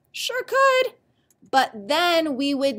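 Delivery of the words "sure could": high, squeaky playful reply; a sudden squeak of glee. Real, not acted. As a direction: pop up high and bright. Teacher answering her own question.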